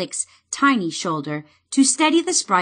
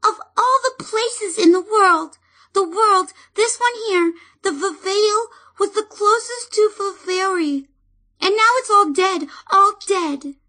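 high, tearful sprite voice in despair; despairing fairy; 12 semitones higher than usual. Acted. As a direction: mourn the dead land, near tears. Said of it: "in despair".

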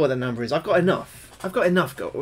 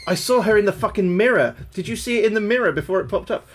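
raised, loud, excited exclaiming; shocked and loud, pitched up; startled disbelief; 6 semitones higher, 7 dB louder than usual. Real, not acted. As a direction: blurt out what you just saw. Startled by ghost in mirror.

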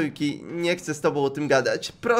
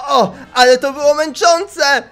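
loud exclaim; 8 semitones higher, 6 dB louder than usual. Acted. Character exhausted after transformation.

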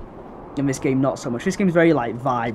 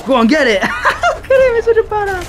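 high, loud laughing cheers; excited laughing cheer; 8 dB louder than usual. Real, not acted. Gamer cheering his dog on, loud laughing cheer. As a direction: egg the dog on while laughing.

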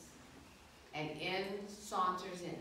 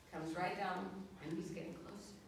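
normal talking voice

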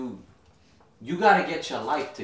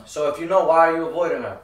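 raised voice; 3 semitones higher, 7 dB louder, faster than usual. Acted. Brother pressing him to answer.

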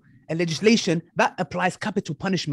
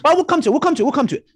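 higher, louder, brushing the question aside; insistent deflecting; 7 semitones higher, 5 dB louder, faster than usual. Real, not acted. A preacher loudly dodging his opponent's question. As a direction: keep repeating it to drown him out.

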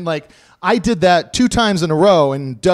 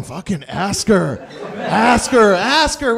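tag; 4 semitones higher, much slower than usual. Retold or imitated. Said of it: "we all start zeroing on the girl going".

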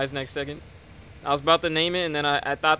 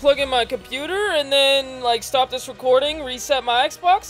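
higher, louder, hyped announcement; excited sign-off; 8 semitones higher, 4 dB louder than usual. Real, not acted. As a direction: wrap it up all pumped. Signs off recording excitedly.

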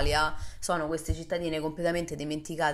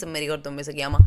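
ordinary talk

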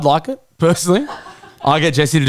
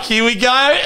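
high, giggling here we go; laughing anticipation; 10 semitones higher, slower than usual. Retold or imitated. Dog starting to heave, laughing high. Hearing the dog about to vomit.

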